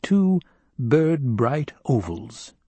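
ordinary delivery

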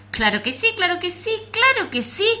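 shouting. Acted. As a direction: yell it, climbing each time. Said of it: "gritaron las tres cucarachas".

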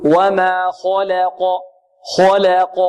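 slow وما خلق with rounded lips on an overblown kha; exaggerated heaviness. Shown as a demonstration. Teacher imitating beginners' overdone tafkhim, exaggerated heavy kha. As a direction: make خلق lurch from a thick kho to a thin la. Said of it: "يبالغ في التفخيم ويضم شفتيه في الخاء".

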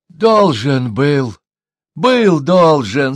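slow, loud, husky answer. A narrator's reading. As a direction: drag the words out hoarsely. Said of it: "сипло ответил шаман".

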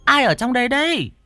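high startled woman's call. A narrator's reading. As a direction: call out as a puzzled woman arriving. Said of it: "Có tiếng gọi vang lên".

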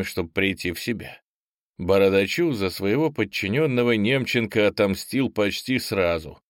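usual speaking voice

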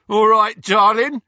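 put-on loud, high drunken shout; drunken yell. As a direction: yell it across the street like a drunk. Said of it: "the drunkest of the men ... was yelling".

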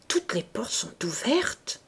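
slow, shaken whisper; stammered. A narrator's reading. The president stammering about his open house, shaken whisper. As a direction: falter the words out, stunned. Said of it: "balbutia-t-il".

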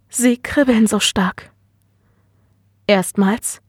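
understated hesitation, with pauses between phrases; hesitant. A narrator's reading. Daughter admits her symptoms to her father, hesitant. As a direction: admit it reluctantly, bit by bit. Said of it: "sagte sie zögerlich".

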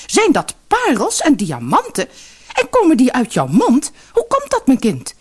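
high, loud, greedy amazement; excited mother. Acted. Mother to daughter over pearls, loud high excitement. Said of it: "stom verbaasd".

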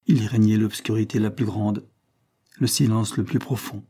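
ordinary delivery, nothing marked

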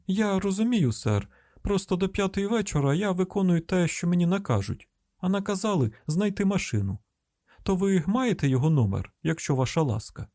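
humble employee on the phone; deferential caller. Acted. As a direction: plead politely, pitched well up.

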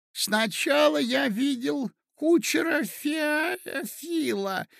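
very high, slow doddering voice; befuddled old prince. Acted. Old prince obligingly recalling events for his nephew, befuddled. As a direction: strain to remember, dragging out the words.

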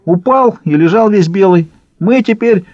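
high-pitched, willing report; eager to tell. A narrator's reading. Уйбуй reports the broken leader to the baron, eager. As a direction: spill the news readily in a high voice. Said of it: "охотно поведал Уйбуй".

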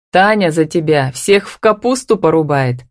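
ordinary delivery, nothing marked

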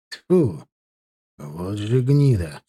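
slow, drawn-out scornful exclamation; disgusted exclamation. A narrator's reading. A man cursing the two-faced official, disgusted exclamation. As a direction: spit it out with contempt, taking your time. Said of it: "воскликнул Ваха".

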